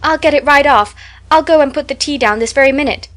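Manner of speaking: flustered eagerness, voice up; anxious hurry. A narrator's reading. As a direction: rush to help her friend. Anne anxiously fussing over Diana.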